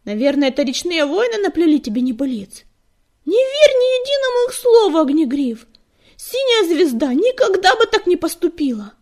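high, loud, outraged denial; indignant denial. A narrator's reading. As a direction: refuse to believe it, voice climbing high. Indignant cat denies the accusation.

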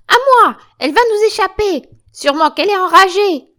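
high, very loud, urgent shouts; alarmed screaming. Acted. Joséphine warns Catherine the captive will escape, screaming in alarm. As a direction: raise the alarm at full voice.

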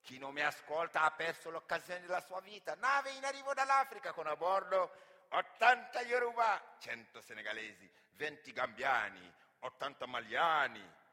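loud, high market barker crying the wares; booming slave-market auctioneer; auctioneer's call. Acted. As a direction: hawk the cargo to the crowd like a fairground crier.

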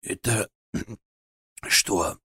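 hoarse and drowsy, slow and soft; hoarse sleepy voice. Acted. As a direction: croak it out half asleep. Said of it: "хриплым сонным голосом".

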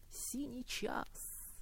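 whispering. Acted. Whispered line of poem.

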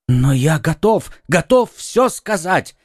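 rising to a high, slowed plea on the second готов; eager, pleading outburst; exclaiming. A narrator's reading. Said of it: "воскликнул Бонасье". (взволнованно, с мольбой восклицая)

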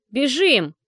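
loud sharp order to run; shouted command. A narrator's reading. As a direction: bark it out, urgent. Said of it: "скомандовала Саша". (громкая команда)

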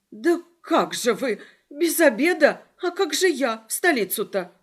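high, loud, dismayed questions; flustered alarm. A narrator's reading. Flo clutching her heart at the sudden news, flustered alarm. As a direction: protest in a startled fluster.

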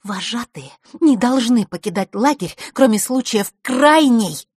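high, loud, emphatic insistence; implacable. A narrator's reading. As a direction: recite the rule, building to a drawn-out end. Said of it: "Рита оставалась неумолима". (неумолимо, высоко и с нажимом)

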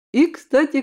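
ordinary delivery, nothing marked

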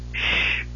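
breathy whispered shush; sharp shush. A narrator's reading. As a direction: hush him with one quick shush. Tanis hushing the kender.

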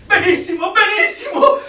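high, loud, laughing burst of delight. Acted. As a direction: gush with glee over the dictionary. Eager, delighted answer about dictionary.

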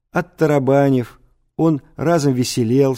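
ordinary delivery

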